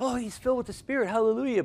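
high, bright mock church enthusiasm; churchy exclamation. Preacher mimics the typical church reaction, high mock praise. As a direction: gush it like a stock churchgoer. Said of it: "you know how you go like".

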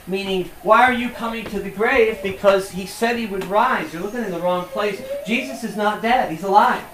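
loud, high, driving sermon peak; full-force preaching. Acted. Preacher to congregation, proclaiming Jesus is alive loudly. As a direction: preach it out to the whole room.

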